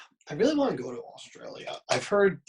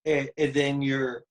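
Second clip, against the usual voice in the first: ordinary delivery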